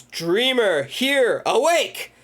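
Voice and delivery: rising, emphatic word-by-word incantation; acted command to end a trance, pitched high; hypnotist's command. Retold hypnotist's command to wake.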